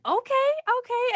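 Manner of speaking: high-pitched mock-amazed reaction; squeaky-high retold astonishment; impressed reaction. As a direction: retell being wowed, way up high. Retells impressed reaction to raspy notes.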